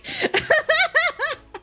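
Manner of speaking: big high burst of laughter. Real, not acted. Teller laughs at the mum's joke.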